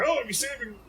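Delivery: mock preachy high voice; high mocking impression of a believer; mocking Hollywood. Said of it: "they make fun of that".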